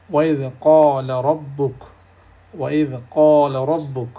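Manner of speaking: ওয়াইদ ক্বালা রাব্বুক with the hamza of ইদ clearly sounded; full hamza. Shown as a demonstration. Teacher modelling full hamza pronunciation. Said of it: "পুরোপুরি উচ্চারণ করতে হচ্ছে".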